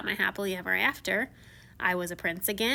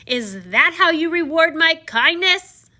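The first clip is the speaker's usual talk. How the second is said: loud, slow, higher beastly roar; 6 semitones higher, 9 dB louder than usual. Acted. Beast roaring at the traveler who took a rose. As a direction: thunder at the thief. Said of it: "I roared".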